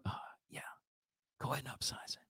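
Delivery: hushed guilty whisper, low. Retold or imitated. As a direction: whisper the upsize like a secret. Sheepish upsell acceptance.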